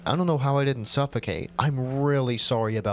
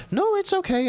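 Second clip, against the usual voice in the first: indignant outburst. Acted. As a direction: burst out in protest. Sick girl pushing back.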